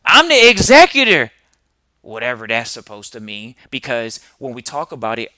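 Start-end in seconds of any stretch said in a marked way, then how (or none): 0.1-1.3 mock loud client. Mocking the client's mix-up.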